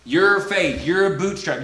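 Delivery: usual speaking voice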